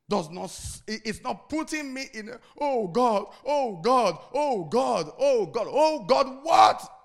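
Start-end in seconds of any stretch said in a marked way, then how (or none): 2.6-6.9 loud, high mock wailing; hammed-up desperate wail of oh God; mock crying. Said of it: "standing in front of me and crying".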